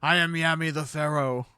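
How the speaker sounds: loud grizzled pharaoh impersonation; Yami. Acted. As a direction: proclaim it in a put-on grizzled voice. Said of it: "the voice".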